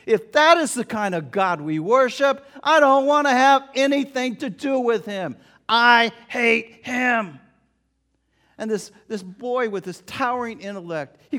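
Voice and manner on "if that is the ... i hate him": put-on loud, furious boy's outburst; shouting through clenched teeth. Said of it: "shouted through clenched teeth".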